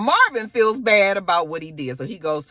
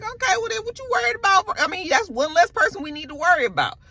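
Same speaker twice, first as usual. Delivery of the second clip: much higher, light teasing voice; mock high voice; 12 semitones higher than usual. Retelling the man teasing Rock, high mock voice. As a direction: pitch it way up as the man. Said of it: "he was just like".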